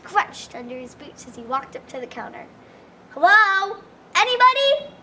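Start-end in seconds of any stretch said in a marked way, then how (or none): 3.1-4.9 higher, louder call into the room. A narrator's reading. Jack calling again into the diner, louder. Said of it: "he asked again".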